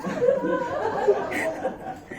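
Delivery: high burst of laughter; loud laugh. Real, not acted. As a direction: break into laughter. Laughs after the quote.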